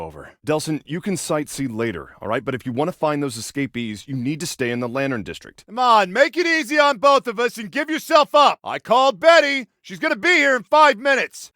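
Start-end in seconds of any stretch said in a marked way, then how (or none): 5.7-11.5 loud, high demand to surrender; insistent raised-voice order; calling out. Acted. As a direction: shout it at someone behind a door.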